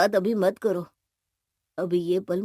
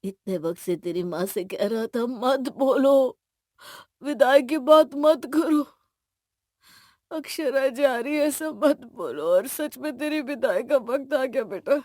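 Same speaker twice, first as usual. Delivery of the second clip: sobbing; 2 semitones higher than usual. Acted. Father facing daughter's farewell, breaking into sobs. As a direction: start contained, then break down crying. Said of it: "एक कोने में खड़े सिसक रहे थे".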